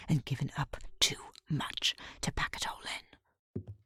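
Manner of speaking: fast, bitter whisper; hissed whisper. Acted. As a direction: spit it out under the breath. Rival's whispered threat, faster.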